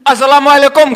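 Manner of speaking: loud, high greeting called across the street; calling out from afar. Retold or imitated. A man on the roadside shouting a greeting. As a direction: hail a passer-by from a distance. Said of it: "Seorang bapak berteriak dari jauh".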